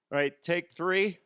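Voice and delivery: loud, high, slow take call; hammed-up rehearsing boss. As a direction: announce the take like an overeager amateur actor. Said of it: "him rehearsing that whole thing".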